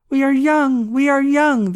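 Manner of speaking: loud, high jubilant shout; exultant cry. A narrator's reading. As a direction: cry it out in triumph, stretching "young". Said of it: "they cried exultingly".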